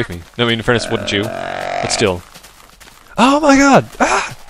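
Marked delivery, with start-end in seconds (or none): 3.2-4.4 very high, loud shriek of panic; frantic scream. Real, not acted. Player shrieking in panic while chasing a fleeing animal. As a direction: scream as the chase goes wrong.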